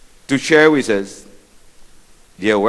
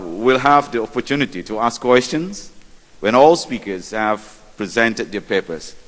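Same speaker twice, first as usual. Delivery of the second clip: ordinary talk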